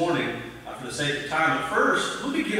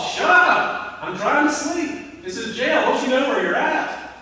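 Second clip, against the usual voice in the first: higher, a mock angry yell down the hall; 8 semitones higher, 4 dB louder, slower than usual. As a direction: shout at the singers to shut up, hammed-up. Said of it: "I'm gonna yell in the hall".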